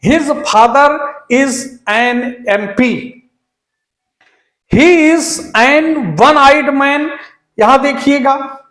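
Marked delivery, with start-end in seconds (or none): 0.1-3.2 slow read-out with "an MP", corrected; slow corrected version. Shown as a demonstration. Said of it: "यहां एन MP आएगा". 4.7-7.1 slow read-out with "an one-eyed", the mistake; slow wrong version. Shown as a demonstration. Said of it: "एन wrong है".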